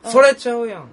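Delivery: a Kanto speaker trying 「それちゃうやん」; mock Kanto attempt. Shown as a demonstration. Co-host imitating a wrong Kansai try: high, loud. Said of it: "とは言わへんな".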